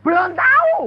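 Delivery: high, loud disbelieving question; shocked echo. Retold or imitated. Pastor repeating the answer in disbelief, loud shocked echo. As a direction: throw the words back in alarm.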